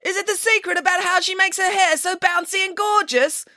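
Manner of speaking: very high, fast-talking reporter voice; female reporter calling out. A narrator's reading. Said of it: "called a female reporter from the front row".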